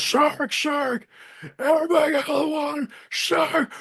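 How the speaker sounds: high, fast, frantic warning call; mock alarmed shout. Sheriff warning swimmers from the tower, high frantic call. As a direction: yell it up high like sounding the alarm.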